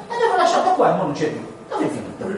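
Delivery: fast, high-pitched mock fretting; worried searching. Looking for someone left right here, mock worried searching. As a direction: fret high and hurried, hammed up lightly. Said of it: "siamo già immediatamente preoccupati".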